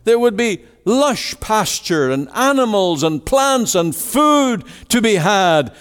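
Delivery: high, driving pulpit cry pleading with the congregation; impassioned preaching. Acted. Preacher crying out to the congregation about God's plan, impassioned preaching. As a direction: preach it at full force, voice lifted high.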